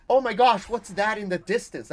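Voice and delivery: lightly hammed-up amazement; overreacting streamer. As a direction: ham up fake surprise like a streamer. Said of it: "I'll see someone's like".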